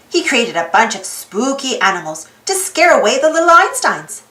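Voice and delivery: ordinary delivery, nothing marked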